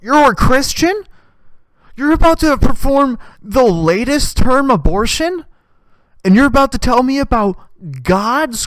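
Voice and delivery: high, loud, disbelieving questions; loud mock-scandalized disbelief; incredulous outburst. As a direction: exclaim in hammed-up outrage, punching each question.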